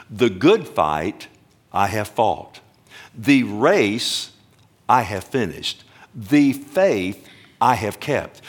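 slow, "good fight", "race" and "faith" stressed, pause after each; stress on the objects. Shown as a demonstration. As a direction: lean hard on "good fight", "race", "faith", ease off "I have". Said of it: "putting the emphasis on the faith".

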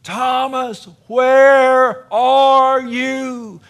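high, loud drawn-out cry; retold frantic yell across the apartments; desperate scream. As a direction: scream for a lost child. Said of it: "I was screaming".

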